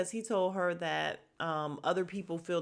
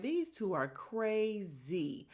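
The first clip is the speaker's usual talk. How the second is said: slow, stretched verdict; drawn-out disbelief; 3 semitones higher, much slower than usual. Retold or imitated. Viewer reacting to the passionate couple, drawn-out disbelief. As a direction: draw it out slowly, lingering on crazy.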